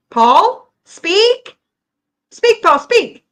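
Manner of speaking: high, loud one-word commands; loud prompting. Real, not acted. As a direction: call him out to speak up. Ordering silent Paul to talk.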